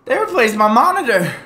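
mock dramatic shock. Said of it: "I was like".

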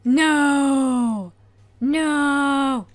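loud, sharp cry of alarm; alarmed shout. Real, not acted. Player yelling at the game in sudden panic. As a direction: yell no at the screen in dismay. Something goes wrong in game.

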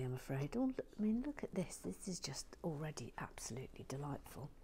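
hushed whisper to the camera; soft whispered aside. Real, not acted. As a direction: whisper so as not to disturb.

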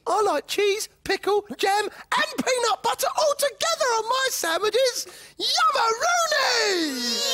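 very high excited food cheer; gleeful squeal. Acted. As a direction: squeal it with greedy delight.

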